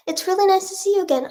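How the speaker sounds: light high-pitched sweet voice; high story character voice. Pretend. Voicing a made-up story character.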